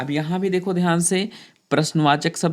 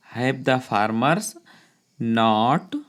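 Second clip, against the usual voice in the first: slow dictation; 4 semitones lower, much slower than usual. Shown as a demonstration. Teacher dictating a sentence.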